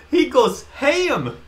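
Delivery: high, slow, excited cry of "ham"; excited exclamation. Real, not acted. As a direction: belt it out in disbelief. Excited about the rescue scene.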